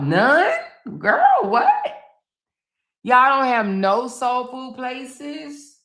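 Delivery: high-pitched disbelief; astonished questioning, stretched at the end; incredulous shock. Real, not acted. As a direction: leap way up in pitch, aghast. Shocked at no soul food there.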